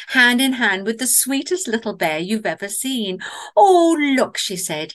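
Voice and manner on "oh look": high, loud delighted "oh"; excited exclamation. A narrator's reading. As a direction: call it out, pitched up and loud. Mama bear calls out to guest.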